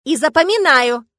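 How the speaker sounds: loud, high, stretched-out threat; outraged slow call; indignant. A narrator's reading. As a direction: draw it out loudly as a warning. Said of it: "возмутилась я".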